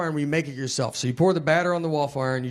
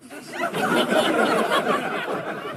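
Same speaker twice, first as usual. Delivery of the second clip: high wheezing laugh. Real, not acted. Wolf joke in coffee shop.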